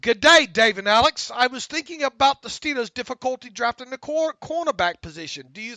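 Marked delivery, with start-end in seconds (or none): 0.0-1.3 loud, hammed-up Australian "good day"; a broad put-on Australian greeting; playful Australian accent impression; mock Australian accent. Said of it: "I'm gonna give you some Southern Australian here".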